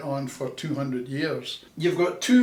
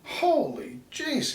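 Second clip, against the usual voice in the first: surprised thinking; 13 semitones higher, slower than usual. Retold or imitated. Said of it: "he's thinking".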